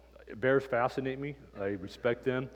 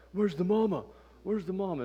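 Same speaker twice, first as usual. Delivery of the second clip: high, anxious where's the mama; worried question; 9 semitones higher than usual. Retold or imitated. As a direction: ask it with alarm, pitched up. Dad worries about mother bear.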